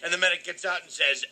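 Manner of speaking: ordinary talk, nothing marked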